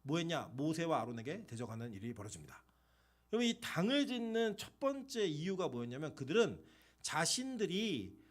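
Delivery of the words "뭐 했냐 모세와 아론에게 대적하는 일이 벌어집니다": ordinary talk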